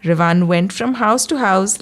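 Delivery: usual speaking voice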